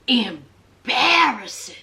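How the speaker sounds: "Embarrassing" drawn out slowly, second syllable punched; emphatic repetition. Real, not acted. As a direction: stretch "Embarrassing", leaning hard on BARR. Repeats the word for emphasis.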